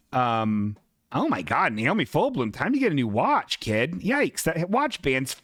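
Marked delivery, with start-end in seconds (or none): none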